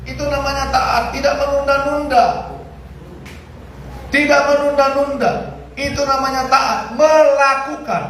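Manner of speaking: fervent sermon voice; preaching at full force. Acted. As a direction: drive the point home to the church.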